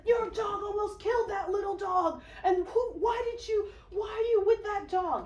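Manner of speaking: high, loud acted outrage; retold outraged screaming. Old man confronting the dog's walker, screaming in outrage. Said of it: "everyone is screaming".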